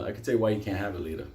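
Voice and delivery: ordinary talk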